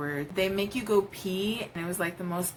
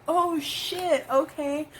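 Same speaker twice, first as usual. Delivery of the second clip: high, loud, startled oh shit; startled realization; 10 semitones higher, 4 dB louder, slower than usual. Retold or imitated. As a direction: caught off guard, then bracing. Her reaction retold.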